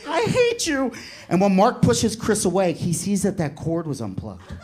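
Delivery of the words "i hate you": put-on high, wailing kid voice; hammed-up tearful squeal; mock crying. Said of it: "Mark starts to cry".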